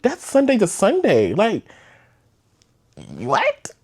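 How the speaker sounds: shrill high what, voice jumping way up; can you believe it, high and incredulous; high pitched disbelieving what; disbelief. Real, not acted. Disbelief at moving so fast.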